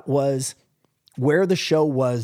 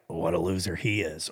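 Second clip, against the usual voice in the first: mock sneer; 10 semitones lower, 8 dB quieter than usual. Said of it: "look at another player like".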